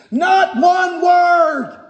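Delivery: loud, high, slow proclamation of "Not one word"; emphatic declaration. Shown as a demonstration. Preacher drives home the verse's key phrase.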